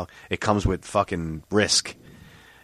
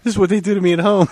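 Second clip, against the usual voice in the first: much higher, louder, fast half-laughing complaint; joking whine; 12 semitones higher, 7 dB louder, faster than usual. Real, not acted. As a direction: whine it like a hard-done-by victim. Joking complaint about family.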